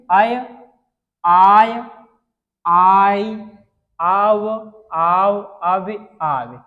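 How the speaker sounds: आय, आई, आव held long and loud like a drill; teacher chanting syllables to copy; drawn-out syllables. Shown as a demonstration. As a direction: call out each sound slowly for pupils. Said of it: "उच्चारण कैसा निकले".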